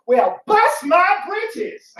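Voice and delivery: high, slow, loud Southern hoot; hammed-up Southern bless-my-britches; Southern exclamation. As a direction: squeal it like a folksy Southern gent. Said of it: "have him respond".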